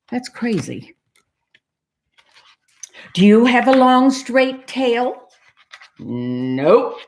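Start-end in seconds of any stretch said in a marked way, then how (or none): 3.1-5.3 loud, higher questioning; pressing question, pitched up; insistent cat. Acted. Cat quizzes the duck again. 6.0-7.0 low, drawn-out "nope"; unbothered low duck reply; deadpan duck. Acted. Duck answers cat's quiz in story.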